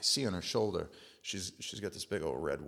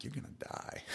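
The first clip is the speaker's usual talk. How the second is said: hushed mock threat, pitched higher; menacing whisper; 7 semitones higher, 8 dB quieter than usual. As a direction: whisper the threat like a movie villain. Vows death to the mosquito.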